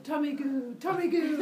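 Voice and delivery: high cartoon war chant; taunting goblins. Tummy goblins throw goo at Kronos, high mock taunt. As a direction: fling each cry out high.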